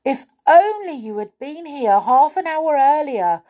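high, loud call after a gasp; gasp, then call out the news; calling out excitedly. Acted. Miss Sunshine calling from her garden, excited. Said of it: "called little Miss Sunshine".

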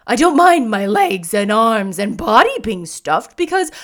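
loud, assured straw-man voice; confident scarecrow. A narrator's reading. Scarecrow explaining that straw cannot be hurt, confident. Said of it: "he continued confidently".

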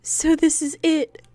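soft, slow resigned line; a hushed girl facing the end; quiet. Acted. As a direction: say it softly to yourself. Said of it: "she said quietly to herself".

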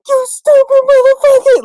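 high, loud furious scream; screaming teacher. Retold or imitated. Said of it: "you could hear him from six halls down".

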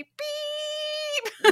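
long, high imitated bleep; censor beep. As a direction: hold a high beep to cover the swear. Self-censors a swear word.

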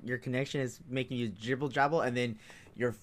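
talking through giggles; fighting back laughter; stifled giggles. Real, not acted. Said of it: "I'm trying not to laugh".

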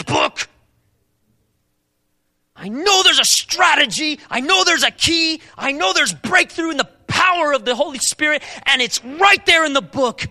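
loud, high, urgent preacher's call; forceful preaching. Acted. Preacher pressing the congregation on seeking wisdom, forceful. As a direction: drive the question home from the pulpit.

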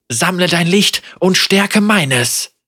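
loud, urgent call to act; rally her to fight; urgent command. A narrator's reading. Senna commands during the fight.

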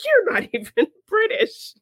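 very high-pitched amused disbelief; mock accusation. Retelling Cooper calling out a fake accent, mock incredulous. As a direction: squeak out the retold accusation with a laugh.

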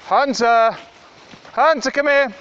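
loud, high call across the field; calling the dogs. Real, not acted. Owner calling her dogs back on a walk, loud high call. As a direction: shout them back to heel.

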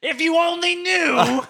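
shrill-free high mock scolding, slowing on the end; bickering girlfriend. Retold girlfriend scolding him for driving barefoot, high mock nag. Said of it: "She's bickering at me".

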